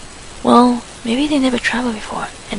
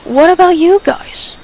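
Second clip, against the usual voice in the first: higher, slow, bright ask; bright question; 6 semitones higher, 6 dB louder, slower than usual. Acted. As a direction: lift the mood on purpose. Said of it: "asked brightly".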